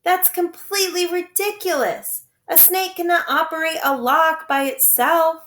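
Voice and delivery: put-on girl objecting to a silly explanation; indignant. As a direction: scoff at the absurd claim. Violet objects to absurd story.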